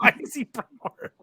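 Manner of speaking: teasing echo. Pretend. Cohost mimics the impression to tease host. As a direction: throw the joke back in his voice.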